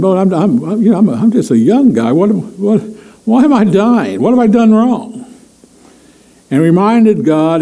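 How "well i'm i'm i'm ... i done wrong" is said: rising from high to very high, more and more distressed; a dying king's pleas climbing in pitch; growing upset king. Retold or imitated. Hezekiah pleads with God, pitch climbing higher and higher. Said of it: "And so he's saying".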